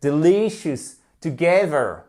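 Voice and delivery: "Delicious, together" as de-LI-cious, to-GE-ther; stress on second syllable. Shown as a demonstration. Teacher showing where word stress falls, second syllables of "Delicious, together" stressed. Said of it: "accent tonique à chaque fois pour les trois mots".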